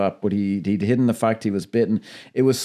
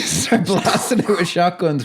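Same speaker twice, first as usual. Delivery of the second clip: normal talking voice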